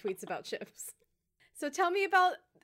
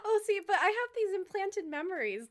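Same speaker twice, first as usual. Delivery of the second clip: high laugh into reply; 9 semitones higher, 5 dB louder than usual. Real, not acted. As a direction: answer while still laughing.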